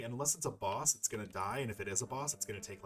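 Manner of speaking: normal talking voice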